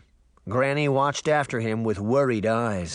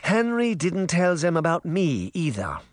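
higher-pitched grandmother voice; Granny's character voice; 7 semitones higher than usual. A narrator's reading. Granny answers her son about Henry, higher grandmother voice. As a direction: play the old woman answering her son. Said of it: "Granny Relda said".

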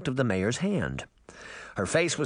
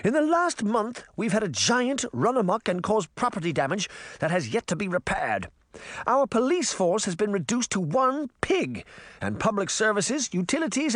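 high, imperious speech to a crowd; haughty Queen of Hearts, ranting; 8 semitones higher than usual. Acted. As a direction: whip the townsfolk against the mayor.

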